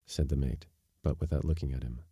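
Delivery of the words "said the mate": plain reading voice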